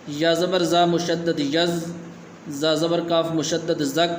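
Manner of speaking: slow letter-by-letter spelling, like a teacher; slow spelling drill. Shown as a demonstration. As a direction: spell it out slowly for a child learner. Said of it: "آج کا سبق ہم شروع کرتے ہیں".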